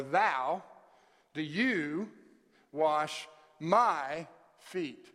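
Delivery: very slow and loud, leaning on "you" and "my"; stress on YOU and MY. Shown as a demonstration. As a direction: hit "you" and "my" as long, separate beats. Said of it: "there are two words that are major emphasis".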